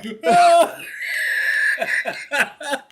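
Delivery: very high, loud belly laugh; real uncontrollable laughing fit; laughing so hard. Real, not acted. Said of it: "laughing so hard".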